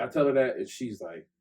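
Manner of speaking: ordinary delivery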